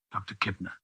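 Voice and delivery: ordinary delivery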